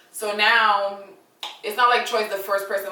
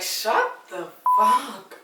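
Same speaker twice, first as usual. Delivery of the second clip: exasperated retort; 3 semitones higher, much slower than usual. Retold or imitated. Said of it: "I'm just looking at everybody like".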